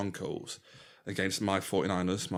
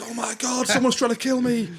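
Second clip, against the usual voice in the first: high, loud panicked Homer squeal; Homer panicking; 8 dB louder than usual. Retold or imitated. Podcaster quoting Homer's panic over the letter, high squeal.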